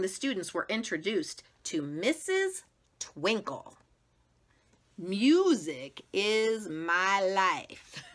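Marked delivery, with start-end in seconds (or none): 5.0-8.0 slow, airy music-teacher voice; drawn out like something from a dream; dreamy teacher voice. Acted. Missus Twinkle to her new class, dreamy and slow.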